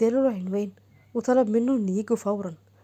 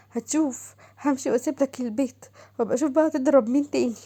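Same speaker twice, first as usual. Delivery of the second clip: higher, aching, bitter voice of a slapped wife; upset wife in pain; 4 semitones higher than usual. Acted. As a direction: moan over the bruise, then vow to leave. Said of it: "اتضايقت شمس قالت". (زوجة متألمة وغاضبة بصوت أعلى)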